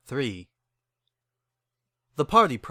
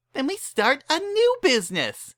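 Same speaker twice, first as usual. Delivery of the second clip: much higher, bubbly pony voice; eager Pinkie pitching in; Pinkie Pie voice; 3 dB louder than usual. Acted. Pinkie urges friends to help, bubbly.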